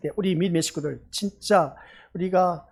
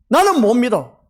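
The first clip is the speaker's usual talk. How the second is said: louder, higher protest of a doubter; defiant refusal; 4 semitones higher, 8 dB louder than usual. Acted. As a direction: refuse loudly, as if voicing the doubters. Voices a doubter's blunt refusal.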